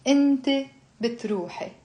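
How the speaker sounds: brisk pronoun and verb. Shown as a demonstration.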